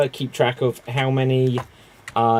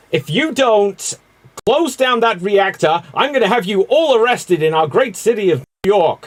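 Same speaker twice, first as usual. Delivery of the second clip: high-pitched, threatening official; hammed-up officious threat; EPA guy from Ghostbusters; 7 semitones higher, 4 dB louder than usual. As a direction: threaten like an indignant government inspector. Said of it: "It's the E P A guy from Ghostbusters".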